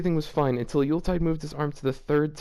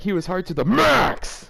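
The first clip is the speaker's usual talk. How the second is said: MAX punched loud, a bit higher; all caps emphasis; 4 semitones higher, 4 dB louder than usual. Shown as a demonstration. Reader acting out the all-caps max, punching the word loud. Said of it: "written in all caps".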